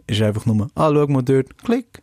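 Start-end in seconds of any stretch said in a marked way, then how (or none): 1.6-2.0 high click sound. Pretend. Mimicking a lazy camera click.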